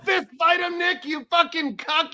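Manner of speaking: goading, high-pitched mock scolding; mock outrage. Co-host ribbing Nick for not fighting, in mock outrage. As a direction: egg him on in pretend indignation.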